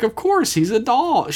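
high-pitched mock indignation; defensive Tendi. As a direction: pitch it way up in comic protest. Tendi's defensive reply acted out.

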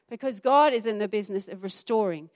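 ordinary talk, nothing marked

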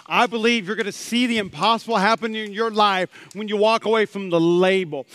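normal talking voice

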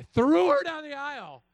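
sudden loud, high shout; mock rage burst. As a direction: roar it out as a joke. Imagined violent act.

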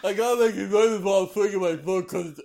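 high goofy dog voice; squeaky, silly sword dog voice; Zacian impression. Still voicing the sword dog.

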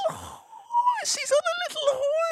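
high-pitched excited squeal; very high, gleeful cry; mock delight. Mock delight at film scene.

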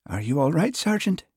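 high, lilting island voice of concern; soft Western Isles lilt. A narrator's reading. Constable checking on blushing sergeant, soft Western Isles lilt. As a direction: ask with a gentle Hebridean lilt. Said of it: "in a soft voice delicately laced with an endearing lilt".

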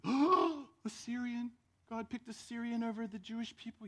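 high, startled outrage; shocked synagogue. Retold or imitated. Synagogue shocked God picked a Syrian, high and startled. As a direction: gasp it in disbelief.

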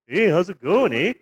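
put-on a light, friendly Canadian accent with a tacked-on eh; lightly voiced cheerful Canadian neighbour; mock Canadian accent. Imitating a polite Canadian Nazi for his co-host, in a light Canadian accent. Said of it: "that Canadian sort of attitude of, like".

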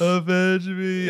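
high, loud hammed-up dying cry; overacted death scene. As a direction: ham up a dying man's last words. Repeat of dying plea.

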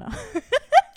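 high, loud burst of laughter. Real, not acted. To the hosts after joking she should have left it up, real loud laugh. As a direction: laugh at her own joke.

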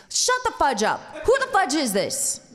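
high, loud mock tirade; retold shouted interruption; irate New York cop. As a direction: cut in as an angry New York sergeant. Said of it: "a New York tater hater".

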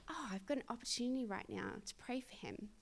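hushed realisation to herself; quiet inner thought. Retold or imitated. Spotting a chance to pray for Lewis, hushed. Voicing her own private thought.

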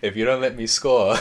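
loud playground ultimatum; bossy threat. Acted. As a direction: threaten them loudly, half laughing. Acted kid threatens other players.

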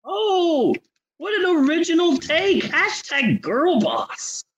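high, mock-impressed praise; slow "Hashtag girl boss" as a sneer; sarcastic gushing. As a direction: fake awe dripping with sarcasm. Mocking the star's script.

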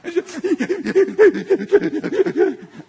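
long run of high mock gasping sobs; hyperventilating crying. Grandpa imitates his crying granddaughter, hyperventilating. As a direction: imitate a child too hysterical to catch breath. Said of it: "she's hyperventilating".

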